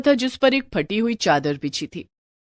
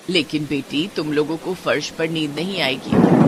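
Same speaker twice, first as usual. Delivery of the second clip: put-on lower, older mother-in-law voice; 6 semitones lower than usual. Mother-in-law tells Anita to take her friends inside, lower elder voice. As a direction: speak as the household's elder woman. Kamla worries about the girls.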